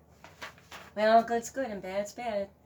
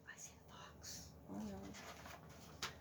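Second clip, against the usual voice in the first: hushed, breathy aside; scared whisper; 20 dB quieter, much slower than usual. Acted. Narrator warning listeners about the fox, whispering. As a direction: whisper it to the children.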